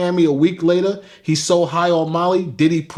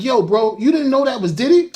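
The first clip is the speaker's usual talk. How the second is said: retold amazement; 7 semitones higher than usual. Retold friend's amazed question.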